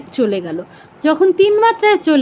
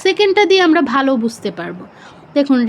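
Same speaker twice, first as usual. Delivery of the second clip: usual speaking voice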